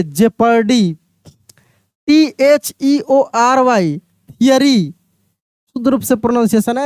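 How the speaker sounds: ordinary delivery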